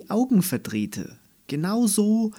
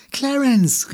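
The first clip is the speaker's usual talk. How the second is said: much higher and louder, overjoyed cry; joyful call; 12 semitones higher, 8 dB louder than usual. A narrator's reading. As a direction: call out his name in pure delight. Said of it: "rief sie". (jubelnd laut gerufen, viel höher)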